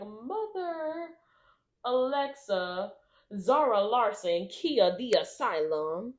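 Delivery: high, drawn-out roll call with swooping pitch; hammed-up Brittany Broski. Podcast guest reeling off pop icons, theatrical. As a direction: list the names like grand proclamations. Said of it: "why you're talking like that".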